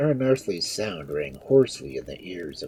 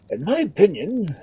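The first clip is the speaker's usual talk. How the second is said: high, raised lordly voice; opining lord; 6 dB louder than usual. A narrator's reading. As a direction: offer counsel in a high, important voice. Lord gives counsel.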